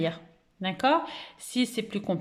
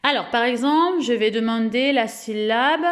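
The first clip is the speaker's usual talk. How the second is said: ordinary talk, nothing marked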